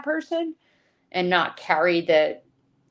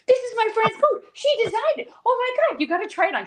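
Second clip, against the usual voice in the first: much higher, eager imitated sales pitch; gushing mock salesman voice; excited salesman. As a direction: hype it to the customers like a proud pushy friend. Imitating salesman friend grabbing customers.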